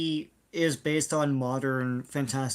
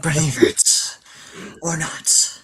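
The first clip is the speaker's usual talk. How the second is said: Jack Palance; slower than usual. Retold or imitated. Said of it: "That's my, uh, Jack Palance".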